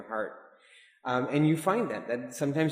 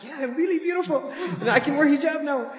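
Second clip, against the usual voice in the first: much higher, giddy mock girl voice with a laugh; mock flattered sisters; 13 semitones higher, 3 dB louder than usual. Sisters answering the speaker's praise, giddy high mock voice.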